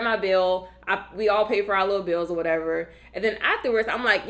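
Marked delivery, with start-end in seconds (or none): none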